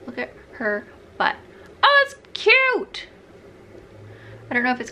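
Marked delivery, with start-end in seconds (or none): 1.8-3.1 excited squeal. Real, not acted. Gushing over the necklace.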